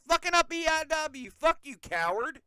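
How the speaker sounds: in a mood and cursing someone out; worked up. Real, not acted. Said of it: "I'm in a mood".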